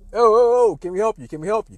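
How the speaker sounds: loud, high opening, then settling; startled challenge. Retold or imitated. Coaches to a stranger in their gym, startled challenge. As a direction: act out being caught off guard by an intruder.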